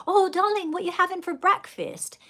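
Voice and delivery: high, lilting mock dad voice; a playful high-pitched dad impression; imitating dad. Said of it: "and he goes".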